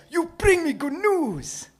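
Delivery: put-on high, loud, delighted old man voice; excited high-pitched character voice; excited old shoemaker. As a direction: cry it out as a thrilled little old shopkeeper. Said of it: "Excitedly, he says".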